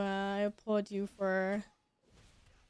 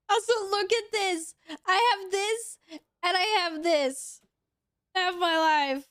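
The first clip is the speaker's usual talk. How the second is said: high, wailing complaint; whiny lament; 7 semitones higher, slower than usual. Real, not acted. Gamer wailing to viewers about gear, whiny lament. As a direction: whine as if near tears.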